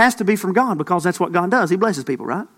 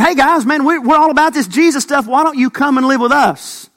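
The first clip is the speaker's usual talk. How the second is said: higher, louder, friendly pitch; eager rich host; 6 semitones higher, 4 dB louder than usual. Acted. Preacher playing a wealthy host luring the disciples, eager. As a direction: sell the pool house to the disciples.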